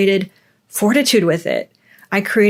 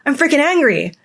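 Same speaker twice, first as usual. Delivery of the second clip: higher, fast defiant declaration, building energy; fired-up resolve; 4 semitones higher than usual. Retold or imitated. Fed up with her life, vowing change: high, fast, defiant. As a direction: spit out a fed-up vow to change things, quick and raised. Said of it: "be like".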